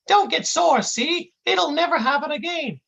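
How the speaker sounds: high-pitched dopey intern; high kid voice. A narrator's reading. Intern soothing the angry agent, high kid voice. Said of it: "the kid says in this high voice".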